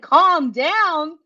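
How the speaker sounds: indignant disbelief at being told to calm down; incredulous echo. Retold or imitated. Repeating her boss Ray's words back at him, indignant.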